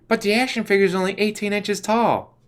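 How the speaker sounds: high sheepish excuse; actor's excuse. Retold or imitated. Actor on his cell phone, making a high, sheepish excuse. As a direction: squeak out a lame excuse. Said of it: "was all".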